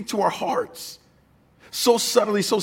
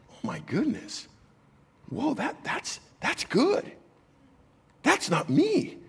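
much higher, softer, slow, breathless wonder; hushed amazement; 7 semitones higher, 7 dB quieter, slower than usual. Retold or imitated. Pastor reliving a sudden sermon idea, hushed and awed. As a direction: gasp it out like the idea just landed on you. Retold moment of sudden inspiration.

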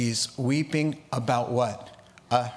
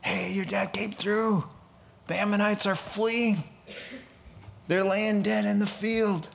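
excited messenger; 5 semitones higher than usual. Retold or imitated. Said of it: "There's talk".

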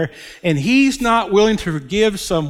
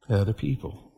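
softer echo; 6 semitones lower, 8 dB quieter, faster than usual. Real, not acted.

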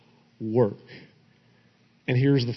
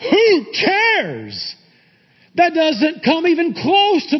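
high, impassioned pulpit delivery; fired-up preacher, pitch soaring; excited preaching; 10 semitones higher, 4 dB louder than usual. Real, not acted. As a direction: build to a ringing proclamation, scoffing at human achievements. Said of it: "It feels like I'm getting excited".

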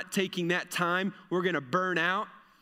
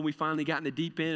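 ordinary talk, nothing marked

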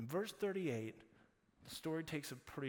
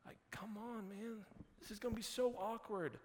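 higher-pitched, awkward imitated pleading; uneasy protest; 6 semitones higher than usual. Disciples to Jesus at the grave, uneasy protest. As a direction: plead lightly, as if embarrassed for a friend.

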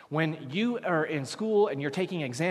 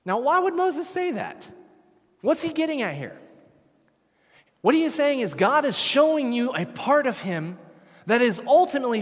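loud, high, urgent sermon delivery; fiery preacher pressing the congregation; preaching at full force; 5 semitones higher, 4 dB louder than usual. Acted. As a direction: drive the point home to the congregation.

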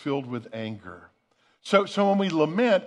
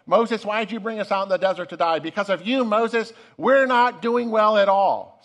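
louder, higher whining accusation, slowing at the end; mock angry grumbling; 5 semitones higher, 4 dB louder than usual. Israelites in the desert blaming Moses, whiny angry grumble. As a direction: act out the people griping at Moses. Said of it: "There's nothing but anger in that".

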